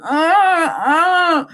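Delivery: high wailing moo-like cry; mock animal wail; imitated crying cow. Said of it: "it was crying, like, going".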